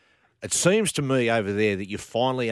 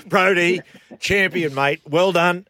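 higher, louder cheering praise; excited congrats; 8 semitones higher, 9 dB louder, slower than usual. Real, not acted. Host cheering the player on his great season, excited and loud. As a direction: hype him up as a champion.

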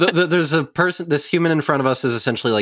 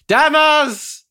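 loud high yell across the camp; shouting a name; 12 semitones higher, 4 dB louder, much slower than usual. Acted. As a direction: call out at the top of your voice. Calling out to friend inside.

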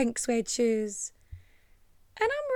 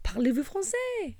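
lightly voiced French concierge; high lilting French question; French accent; 7 semitones higher than usual. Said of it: "he's like".

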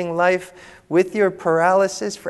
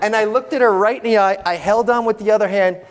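ordinary delivery

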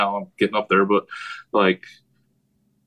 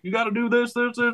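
high-pitched imitation of a yeller; mock coach's rant, pitched way up; yelling coach; 12 semitones higher than usual. Imitating a fellow coach getting on players, mock yelling. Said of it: "a coach on my staff that's a yeller".

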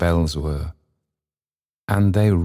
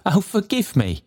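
put-on high, pleading old man's voice; Trotty; 13 semitones higher than usual. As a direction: beg forgiveness in a much higher pitch.

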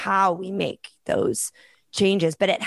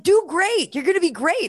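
lightly voiced little boy cheering her on, higher; child's voice; 10 semitones higher than usual. Mother quoting her son's pep talk, higher child voice. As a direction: imitate a small son's encouragement.

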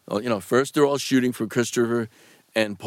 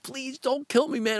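high-pitched, softer pleading; mock begging; 13 semitones higher, 5 dB quieter than usual. Actor recalls his death scene choice, high mock begging. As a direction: re-enact a plea for your life. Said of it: "I was begging".

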